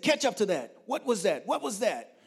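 high, loud, urgent questioning; excited demand. Retold or imitated. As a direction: call out to the driver in excitement. Retold excited questions to driver.

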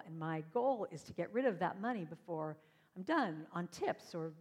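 usual speaking voice

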